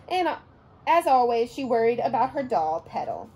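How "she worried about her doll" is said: ordinary delivery, nothing marked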